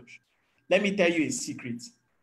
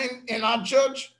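higher, quick mock boast of a flawless church; a half-laughing send-up of a boastful church; mocking parody; 5 semitones higher than usual. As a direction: poke fun at the pious claim.

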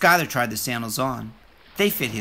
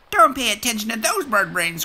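high, louder crab pal; 8 semitones higher, 4 dB louder than usual. Acted. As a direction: brush off the bullies for your friend. Krabby comforting Skylar.

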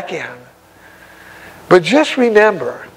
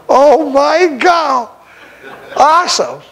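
laughing in awe; 7 semitones higher, slower than usual. Real, not acted. Overwhelmed joy.

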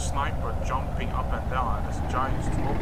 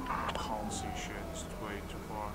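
whispering; 4 semitones lower, 15 dB quieter than usual. Real, not acted. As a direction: breathe it out under your breath. Mutters while aiming from afar.

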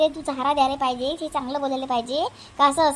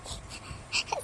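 muffled sob; 11 dB quieter than usual. Acted. Girl breaks down crying.

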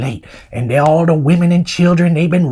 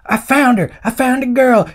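higher, eager mock outcry; excited searcher; 6 semitones higher than usual. Searcher telling uncle he found the girl, excited cry. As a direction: call out the find in acted excitement.

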